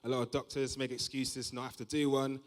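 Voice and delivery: ordinary delivery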